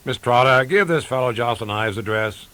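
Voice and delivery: loud, impatient office call; calling to secretary. A narrator's reading. Harried boss calls to secretary.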